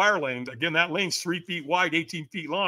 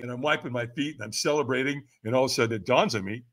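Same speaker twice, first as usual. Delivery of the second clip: ordinary talk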